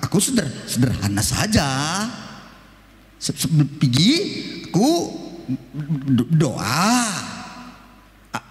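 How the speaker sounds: halting stutter, slowing at the end; stuttering salesman. Retold or imitated. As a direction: stumble through the simple method. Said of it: "Bagaimana caranya?".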